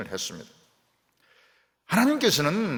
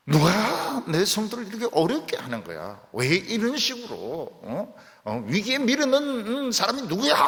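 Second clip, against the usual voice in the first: higher, louder, indignant questioning; God's angry outburst; 8 semitones higher, 4 dB louder than usual. Acted. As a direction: demand to know who hurt his saints. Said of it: "하나님 너무너무 화를 내시니까". (높고 큰 소리로 분노하며 따지는 하나님)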